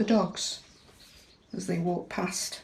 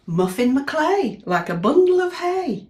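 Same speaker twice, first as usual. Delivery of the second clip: lively rhyme; 10 semitones higher, 9 dB louder, slower than usual. Acted. Reading the rhyme aloud.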